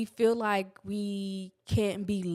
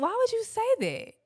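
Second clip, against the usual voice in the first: much higher, squeaked disbelief; shocked retort; 14 semitones higher than usual. Retold or imitated. Daughter answering mom's grim remark, high disbelief. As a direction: fire it back, stunned. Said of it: "And I'm like".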